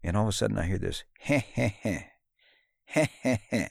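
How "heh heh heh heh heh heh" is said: chuckling. Retold or imitated. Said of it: "big grin on his face".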